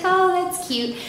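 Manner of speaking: high, light, charmed; sweet, amused. Retold or imitated. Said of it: "And she's like".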